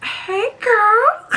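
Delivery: high, sing-song mock of a flirting guy; imitating Special K's cheesy phone greeting; flirty guy imitation. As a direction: ham up his cheesy pickup line. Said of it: "that's what he actually says".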